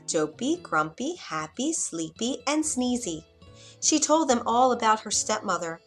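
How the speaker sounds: ordinary delivery, nothing marked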